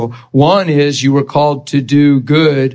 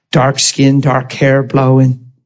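normal talking voice

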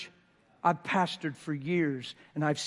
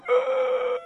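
high broken cry of relief; 7 dB louder than usual. Retold or imitated. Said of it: "He's like".

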